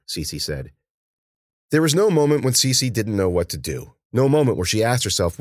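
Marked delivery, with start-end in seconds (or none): none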